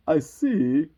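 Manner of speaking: loud, put-on regal voice; imperious Queen. Queen examining the roses, loud and imperious. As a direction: pronounce it loudly and grandly. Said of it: "said the Queen".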